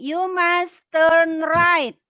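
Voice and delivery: slow, loud model sentence; slow teacher demo line; slow model reading. Shown as a demonstration. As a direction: read it slowly for the class to echo. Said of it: "Yuk ikutin [name]".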